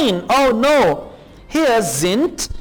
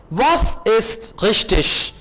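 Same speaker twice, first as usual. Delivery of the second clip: slow model reading; much slower than usual. Shown as a demonstration. Slow German model phrase.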